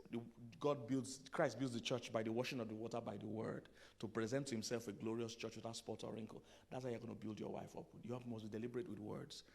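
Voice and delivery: usual speaking voice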